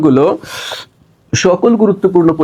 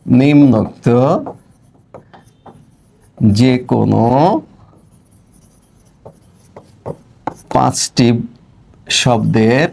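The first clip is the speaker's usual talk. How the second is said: words dropped one by one with long pauses, as if writing on the board; slow dictation; 6 semitones lower than usual. Acted. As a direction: dictate slowly while writing it out. Dictating question while writing. (ধীরে ধীরে শ্রুতলিপি দেওয়া)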